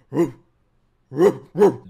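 loud barking "Roof, roof, roof" like a dog; mock dog bark. Joking about what the anime dog should sound like, mock barking. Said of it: "I thought he was gonna be like".

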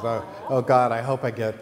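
loud, anxious burst of hoping; a child's mock-desperate plea; anxious pleading. Girl hoping for another chance at the plant, anxious pleading.

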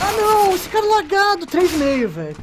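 high, loud cry of protest; high-pitched gamer rage; real frustrated outburst. Real, not acted. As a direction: shout in exasperation at an unfair game death. Dies to a lagging player.

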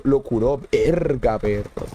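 ordinary delivery

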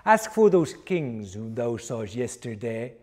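ordinary delivery, nothing marked

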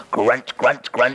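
low piggy grunts; pig grunting. Acted. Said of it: "And a pig goes".

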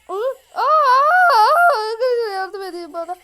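high loud alarmed aa sounds; panicked cries. Real, not acted. Gamer caught by the enemy, high loud panicked yelps. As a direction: yelp in sudden panic. Attacked suddenly in game.

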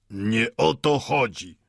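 slow, grandly weighted words; pompous pathos. A narrator's reading. Watchmaker corrects old Mendel, pompous. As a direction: draw it out with self-important solemnity. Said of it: "rzekł patetycznie zegarmistrz".

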